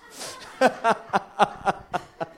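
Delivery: loud burst of laughter; hearty run of laughs. Real, not acted. Preacher amused by his own story, laughing out loud.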